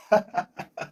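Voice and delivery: real, high-pitched chuckle; laugh. Real, not acted. Teacher amused by the suggestion "jealous", high laugh.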